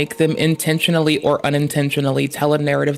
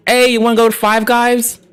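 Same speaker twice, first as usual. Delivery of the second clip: higher, louder breezy mock ask; casual friend question; 7 semitones higher, 5 dB louder than usual. To his buddy about food, a breezy higher louder ask. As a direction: toss it off like texting a buddy. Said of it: "We'll be like".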